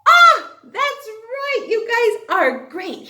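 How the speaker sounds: slow, high and loud delighted ah; excited praise. Acted. Teacher praises the class's answer, slow and thrilled. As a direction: draw out the delight.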